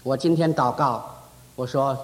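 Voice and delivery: ordinary talk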